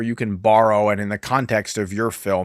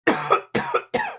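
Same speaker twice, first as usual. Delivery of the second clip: choking cough; 3 dB quieter than usual. Real, not acted. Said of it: "[name]'s got wine on the lung now".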